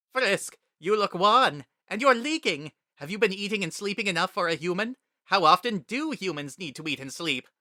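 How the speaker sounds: Papyrus, fussing. A narrator's reading. Papyrus fretting over tearful Frisk, high loud fussing. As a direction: worry over the human with big energy.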